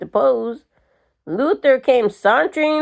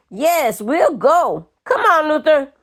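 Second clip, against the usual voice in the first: belligerent; 6 semitones higher than usual. A narrator's reading. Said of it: "fat and belligerent".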